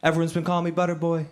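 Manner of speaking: put-on high, fast, whiny teenage boy; high pitched helpless kid voice. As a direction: plead like a bullied kid to the counselor. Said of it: "he's like".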